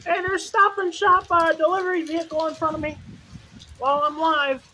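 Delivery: ordinary talk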